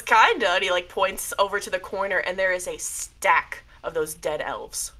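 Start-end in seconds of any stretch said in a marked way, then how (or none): 0.0-0.5 a light laughing kinda; mild amused spirit; chuckling. Acted. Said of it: "he laughs a little bit".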